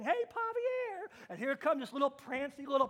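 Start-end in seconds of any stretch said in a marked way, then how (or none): 0.0-1.1 higher, slow sing-song call of a silly name; embarrassed call. Retold or imitated. Teenager calling the prancy Pomeranian, slow and high. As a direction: call the fancy dog name out, drawn out. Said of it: "being a teenager saying".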